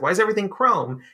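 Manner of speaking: high-pitched cartoon alarm, played for fun; mock freak-out. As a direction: imitate a cartoon character freaking out. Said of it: "freaks out".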